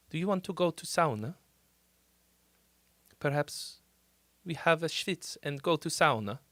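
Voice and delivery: soft mock Finnish accent on "sauna". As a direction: lightly imitate a Finnish speaker, softly. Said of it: "they talk, they're like".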